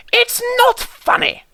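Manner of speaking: very high sulky shout; angry James. Acted. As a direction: James yelling he is offended. James retorts.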